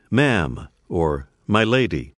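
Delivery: usual speaking voice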